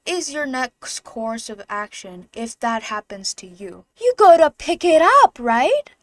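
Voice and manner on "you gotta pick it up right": loud indignant question. Real, not acted. Loud setup before the shout.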